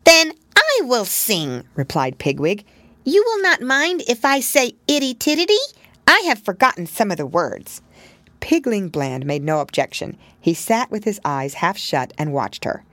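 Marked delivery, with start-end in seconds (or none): 0.0-7.8 little pig voice. Acted. Pigwig offers to sing for Pigling. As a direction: announce it proudly.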